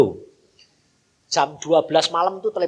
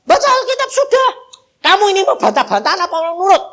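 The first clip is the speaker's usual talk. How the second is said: much higher, loud, fast scolding; mock rebuke; 11 dB louder, faster than usual. Pastor rebuking the caller who argues back, loud and high. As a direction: scold him into obeying.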